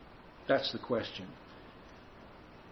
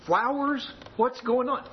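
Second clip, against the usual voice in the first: mock surprised, higher voice; a wife baffled by flowers, pitched up; 9 semitones higher, slower than usual.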